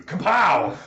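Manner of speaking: a gleeful sound-effect shout; loud comic kapow. Acted. Game master calling the big hit, loud and gleeful. GM finishes Freya's sneak attack.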